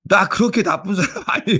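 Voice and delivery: lightly voiced offended child; childlike protest. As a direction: mimic the kid's indignant denial, laughing. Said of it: "어린아이답게".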